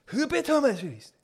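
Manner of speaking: very high, slow, hammed-up Chinese accent; mock Chinese accent. Comedian repeating his own bit, exaggerated Chinese accent. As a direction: squeal "Optometrist" in a drawn-out caricature Chinese accent. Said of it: "me saying it like that".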